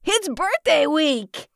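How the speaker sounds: high, stretched-out cheer; celebratory call without real shouting; excited call. A narrator's reading. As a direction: announce it gleefully out the window. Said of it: "he shouted out the window".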